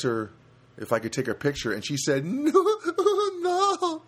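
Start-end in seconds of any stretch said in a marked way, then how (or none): 2.2-4.0 very high, laughing mock Rosie; Rosie's broken English. Imitating Rosie refusing her photo, laughing and squeaky. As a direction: giggle through a coy refusal in broken English. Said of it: "That's how she talks".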